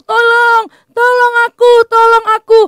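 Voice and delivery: panicked shouting plea for rescue; desperate cry for help. Acted. (teriakan panik minta tolong)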